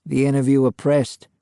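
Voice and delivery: ordinary delivery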